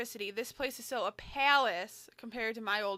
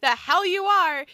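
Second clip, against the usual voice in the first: angry retort; 5 semitones higher, 9 dB louder than usual. Acted. Mother forbidding trip.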